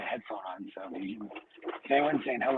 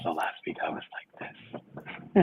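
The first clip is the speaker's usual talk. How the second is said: soft unvoiced whisper; 6 semitones lower, 9 dB quieter than usual. Shown as a demonstration. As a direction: show how faint it was. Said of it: "I could only whisper".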